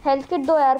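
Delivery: urgent loud call for help; cover me shout. Real, not acted. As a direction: shout for a health kit mid-fight. Begging teammates for health kit. (जोर से मदद की पुकार)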